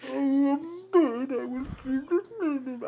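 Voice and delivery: put-on slow, high whiny rich kid; entitled private school drawl. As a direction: whine it out like a spoiled brat. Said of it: "he's like".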